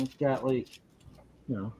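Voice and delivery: usual speaking voice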